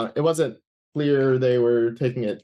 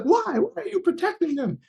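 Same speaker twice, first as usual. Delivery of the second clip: much higher, mock-exasperated demand; imitating angry reporter. CNN reporter angrily pressing the guest to name attackers, imitated high. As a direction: squeak it out like a baffled, angry reporter. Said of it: "and he was so angry".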